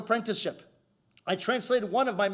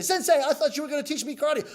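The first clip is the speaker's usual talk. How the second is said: higher-pitched whiny protest to a teacher; complaining student; 5 semitones higher than usual. Acted. Student complaining to his sensei, high aggrieved protest. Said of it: "So he complains to Sensei".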